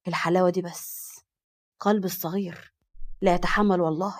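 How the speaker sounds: a lightly voiced man struck by her beauty; dazzled Farid. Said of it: "انبهر من جمالها وقال". (صوت رجل منخفض فيه انبهار خفيف)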